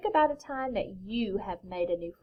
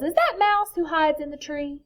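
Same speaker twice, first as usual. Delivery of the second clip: raised, high storybook call; 6 semitones higher, 6 dB louder than usual. A narrator's reading. Bear to whoever hides in the tree, calling out. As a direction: call up into the tree, higher and louder. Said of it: "Bear calls".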